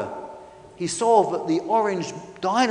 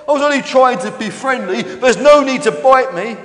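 higher, loud, wounded complaint; indignant protest; 6 semitones higher, 10 dB louder than usual. Acted. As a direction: protest loudly at being bitten. Hurt dinosaur protests being bitten.

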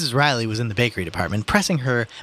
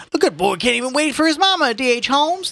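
put-on high, complaining old-timer; old man; 4 dB louder than usual. Old man objecting to the arrest, high and complaining. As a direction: protest in an old man's raised pitch. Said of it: "the old man said again".

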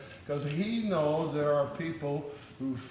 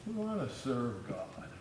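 scoffing doubters; 3 semitones higher, 13 dB quieter than usual. Retold or imitated. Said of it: "That was their attitude".

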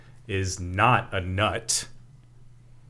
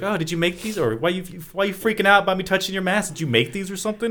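higher-pitched imitation of a pestering boy; imitated kid; 8 semitones higher, faster than usual. Host retelling Reggie questioning Tommy about masks, imitated kid. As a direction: play the kid firing off questions. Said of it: "And then he's like".

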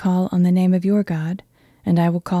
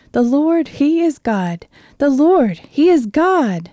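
awed cry; 7 semitones higher, 4 dB louder, slower than usual. A narrator's reading. People fall face down crying.

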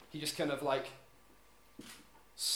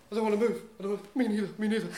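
whiny, higher pitched complaining; whiny bored grumble; 5 semitones higher, 4 dB louder, much faster than usual. Retold or imitated. Narrator mimicking bored people, whiny and raised.

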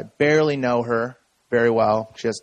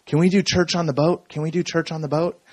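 lightly voiced eight-year-old, pitched up; eager child; 4 semitones higher than usual. Kid asking uncle for church on the boat, imitated eager child. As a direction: imitate a kid's higher-pitched pleading. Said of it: "eight years old, and says".

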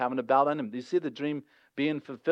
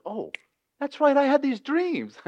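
much higher, airy, ditzy recall; mock offhand remembering; 11 semitones higher than usual. Mocking a careless Joseph who just forgot, high and airy. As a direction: play it as a silly casual oh-right. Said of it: "That wasn't a remember like".

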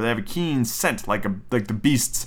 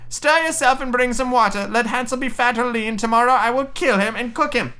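high, commanding witch voice; witch crying orders; 11 semitones higher than usual. Acted. Witch ordering Gretel to fetch water, high cry. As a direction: order the girl about in a high, menacing cry. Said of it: "she cried to the girl".

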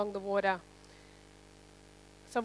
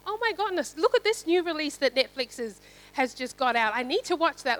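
higher-pitched mock-excited gushing; excited distraction; 3 semitones higher, 3 dB louder than usual. Preacher mimicking someone distracted by a new Netflix release, mock excited. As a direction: play up being suddenly hooked by something shiny.

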